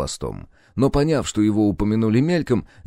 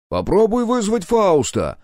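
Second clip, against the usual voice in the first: high, slow call down a corridor; drawn-out, high-pitched shout; shouting from afar; 12 semitones higher, 4 dB louder, slower than usual. A narrator's reading. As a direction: call it out to the next room. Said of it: "крикнул из коридора Клаус".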